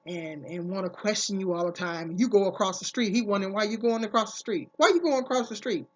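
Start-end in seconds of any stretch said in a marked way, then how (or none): 4.8-5.8 louder, higher mock interrogation; demanding questioning. Controlling man questions her about crossing the street, mock demanding. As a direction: imitate a suspicious partner's challenge.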